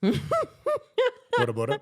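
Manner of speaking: very high and slow; high drawn-out. Shown as a demonstration. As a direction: stretch it up high. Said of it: "starts with a B".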